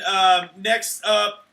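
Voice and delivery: ordinary talk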